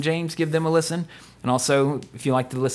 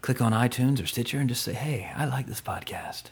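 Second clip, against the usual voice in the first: breathy whispered sultry plea; sultry perfume ad; 7 dB quieter than usual. Pretend. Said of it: "my sultry voice".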